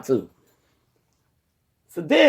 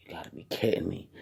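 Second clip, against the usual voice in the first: weary disbelief; 9 semitones lower, 13 dB quieter, faster than usual. Retold or imitated. Told she needs surgery, sighs.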